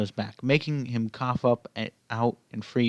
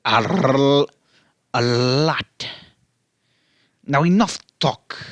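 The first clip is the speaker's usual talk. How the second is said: louder, gruff cartoon villain reply; Wario villain; 5 dB louder, slower than usual. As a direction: cut the chatter as the brute.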